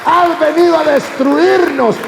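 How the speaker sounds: loud, high cry as the demon; high-pitched possessed outcry; demon's shouting. Acted. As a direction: shout it out like a demon confronting Jesus. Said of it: "El demonio dijo".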